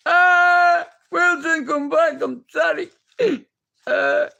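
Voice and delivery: anguished, high loud castaway wail; Tom Hanks. Acted. Castaway calling after his lost Wilson, mock anguished. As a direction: cry out desperately, hammed-up grief. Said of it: "he was great in that, Tom Hanks".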